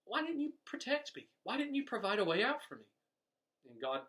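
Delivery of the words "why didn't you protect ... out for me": high, pleading why to God; lightly voiced bewildered preacher; puzzled plea. Dead preacher in heaven asks God why, high and pleading. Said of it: "he's just puzzled and he says".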